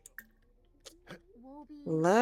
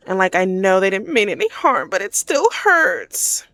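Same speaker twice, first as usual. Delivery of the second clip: mock crying; 3 semitones higher, 8 dB louder than usual. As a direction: act out an upset character pleading through her hurt. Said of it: "You see how she's crying?".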